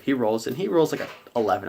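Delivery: usual speaking voice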